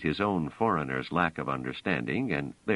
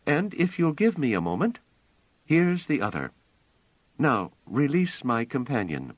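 higher, lightly voiced young woman; Bliss; 8 semitones higher than usual. A narrator's reading. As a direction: speak a little higher as the woman.

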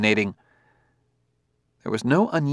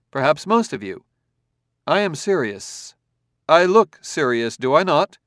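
calm, firm robot authority, a touch higher; confident command; 6 semitones higher, 5 dB louder than usual. A narrator's reading. Robot reasoning calmly with the angry crowd. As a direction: lay it out coolly, no anger. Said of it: "confident command".

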